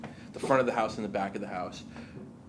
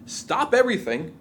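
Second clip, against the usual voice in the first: the kitchen gets halted mid-rush, a raised, urgent order; sudden order; 8 semitones higher, 5 dB louder than usual. Acted. Kitchen halted mid-rush, sudden loud order. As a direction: call it out higher and louder.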